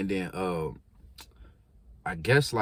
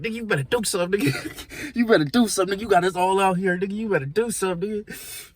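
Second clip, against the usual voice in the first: partner heckling; 7 semitones higher, faster than usual. Retold or imitated. Partner yelling at losing friend.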